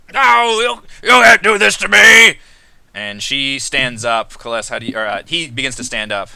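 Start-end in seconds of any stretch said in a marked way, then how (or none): 0.1-2.4 desperate protest. Acted. Commander protests being relieved.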